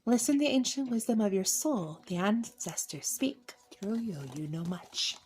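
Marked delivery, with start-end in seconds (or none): 2.1-5.2 hushed oracle whisper; mystic whisper. Acted. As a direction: whisper it like ancient wisdom. Mystic card reading.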